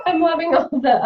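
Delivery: high burst of real laughter; laughing. Real, not acted. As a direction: laugh while you talk. Laughing at chat emojis.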